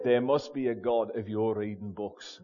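low, soft imitated Scouse; Liverpool accent. Dad reacts to son reading, low soft Liverpool accent. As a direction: mutter it as an amazed Liverpool dad. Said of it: "in his broad Liverpool accent".

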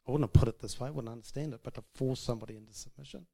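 ordinary talk

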